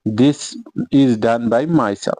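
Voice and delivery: each word spaced out slowly; teacher dictating slowly; slow dictation. Shown as a demonstration. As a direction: read it out slowly for the class to copy. Teacher dictates example sentence.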